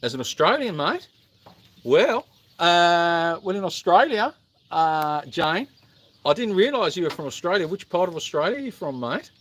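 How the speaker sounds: hammed-up Australian accent. Shown as a demonstration. Streamer showing an Australian viewer their version, mock Australian accent. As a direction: put on a broad, matey Australian accent. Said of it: "hear your version of the accent".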